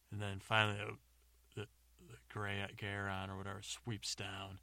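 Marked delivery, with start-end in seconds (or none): none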